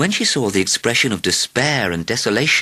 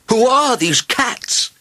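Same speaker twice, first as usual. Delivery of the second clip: very high, drawn-out amazed cry; astonished king, voice soaring; 4 dB louder, much slower than usual. A narrator's reading. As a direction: call out slowly in high-pitched wonder. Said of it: "he cried".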